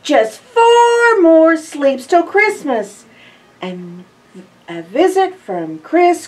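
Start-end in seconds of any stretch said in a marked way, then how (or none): 0.0-3.1 higher, louder, slow excited refrain. Acted. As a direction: sing out the countdown with glee.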